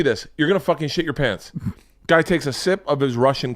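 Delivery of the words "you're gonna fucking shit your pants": ordinary delivery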